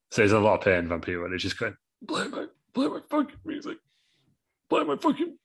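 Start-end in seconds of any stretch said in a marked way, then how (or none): 2.1-5.4 very high, strained, fading plea; dying squeak. Acted. Vampiro dying after the glass table, squeaking high. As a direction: squeeze it out as his last breath.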